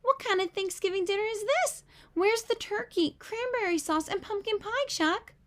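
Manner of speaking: a lightly voiced girl grumbling about the food; indignant complaint. A narrator's reading. Girl complaining to host about missing turkey, mildly indignant. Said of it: "Peppermint Patty frowns".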